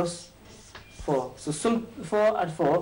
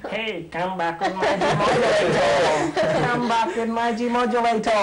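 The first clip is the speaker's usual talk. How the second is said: slow, higher cartoon alien whining through a laugh; 5 semitones higher, slower than usual. Teacher entertaining pupils by imitating the cartoon alien, slow and laughing. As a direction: do the little alien chasing Bugs Bunny. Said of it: "he talks like this".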